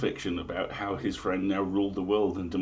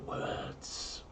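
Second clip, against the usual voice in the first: hushed conspiratorial whisper; 11 dB quieter than usual. Acted. Host whispering the omen's punchline to listeners, whisper. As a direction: drop to a secret whisper for the twist.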